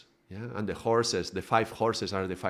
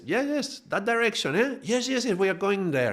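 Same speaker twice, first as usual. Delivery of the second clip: very high, eager agreement; gullible captain; 10 semitones higher than usual. Retold or imitated. Captain swallows the ego's excuse, very high and eager. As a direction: happily accept the lie. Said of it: "the captain likes".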